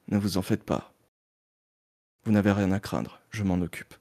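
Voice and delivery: deep, hushed noir hero; reassuring detective. Acted. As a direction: reassure the client low and softly. Detective reassures client while leaving. (détective rassurant, voix grave et feutrée)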